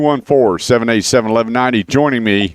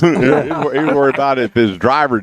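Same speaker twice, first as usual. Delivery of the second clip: higher-pitched, laughing through the words; 6 semitones higher than usual. Real, not acted. Host joking about his son's golf clubs, laughing. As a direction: chuckle along with the joke.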